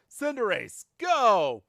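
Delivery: high, loud command to send out Cinderace; battle cry. Acted. Go sends out Cinderace.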